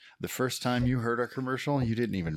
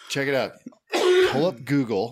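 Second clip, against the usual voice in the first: emphatic; 6 semitones higher, 8 dB louder than usual. Acted. Instructing listeners emphatically.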